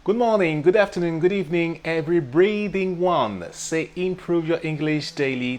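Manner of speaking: high, loud, slow announcer welcome; live show opener. Acted. Host greeting his live viewers, big announcer voice. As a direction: boom it out like a host going on air.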